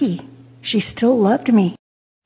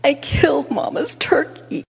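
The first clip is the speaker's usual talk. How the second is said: softer, mock-tearful confession; imitated crying; 3 semitones higher, 5 dB quieter than usual. Crying child confessing to her sister, mock tearful. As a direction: act out a child confessing through tears. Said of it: "All I could do was cry and say".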